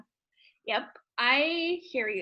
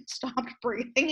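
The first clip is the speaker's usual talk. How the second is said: ordinary delivery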